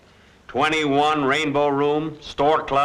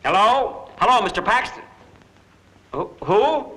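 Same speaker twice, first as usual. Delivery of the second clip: raised voice into the phone; 7 semitones higher than usual. Acted. Phoning, caller unclear.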